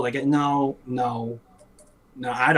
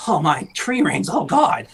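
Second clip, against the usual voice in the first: slow, louder, whining fret; mock whiny researcher; 6 semitones higher, 4 dB louder, slower than usual. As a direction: moan over his tree rings.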